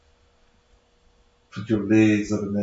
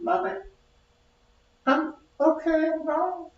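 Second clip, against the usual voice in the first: put-on baby voice; 3 dB quieter than usual. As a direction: whimper it like an unborn child. Baby voice again.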